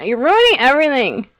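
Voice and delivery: hammed-up whiny outburst; mock outrage. Repeats the scolding, escalating.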